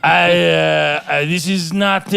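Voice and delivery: high, loud French buyer voice; hammed-up French accent. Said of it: "in that French accent".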